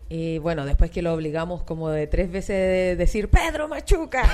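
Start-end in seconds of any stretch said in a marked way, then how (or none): 3.3-4.3 shouted catchphrase. Retold or imitated. Drunk friends forcing a catchphrase.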